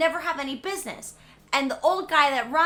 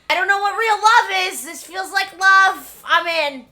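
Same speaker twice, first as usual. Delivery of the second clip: lovestruck orphan; 7 semitones higher, 11 dB louder than usual. Retold or imitated. Voicing orphan Seymour's reply.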